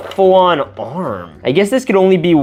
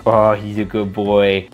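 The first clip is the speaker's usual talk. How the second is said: puppy talk; 7 semitones lower than usual. Acted. Cooing to toy dog.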